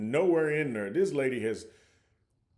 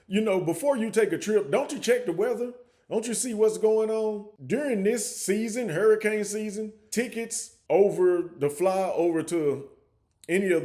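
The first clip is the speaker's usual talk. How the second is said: higher, pressing rhetorical questions; scolding; 6 semitones higher, 3 dB louder than usual. Real, not acted. Vlogger scolding tourists who ignored hurricane season, higher. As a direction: needle them with the obvious.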